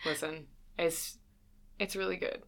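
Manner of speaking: ordinary talk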